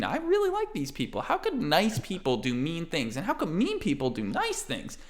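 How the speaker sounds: put-on very high, bouncy little boy voice; kid Aiden. Aiden the kid chirping happily to his pops and the party. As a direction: chatter like an excitable little kid.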